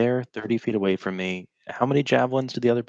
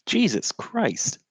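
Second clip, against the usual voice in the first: high-pitched, drawn-out disbelief; dismayed exclamation; 12 semitones higher, much slower than usual. Real, not acted. Player reacting to being outnumbered by bugbear chiefs, dismayed. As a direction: draw it out high, aghast.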